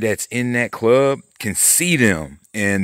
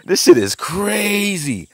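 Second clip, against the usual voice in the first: excited; 10 semitones higher, 5 dB louder, slower than usual. Real, not acted. Repeats slower after laugh.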